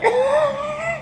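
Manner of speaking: real laugh, squealing high; cackling laugh at own joke, high; high shrieking laugh. Real, not acted. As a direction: let out a high cry-laugh.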